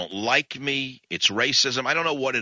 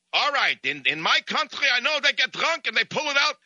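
put-on Russian accent; 12 semitones higher than usual. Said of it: "an enraged Russian".

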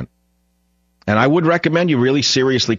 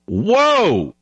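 high, drawn-out whoa of astonishment; stunned exclamation, pitched way up; amazed whoa; 12 semitones higher than usual. Real, not acted. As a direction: let the whoa soar up in surprise. Reacting to startling study news.

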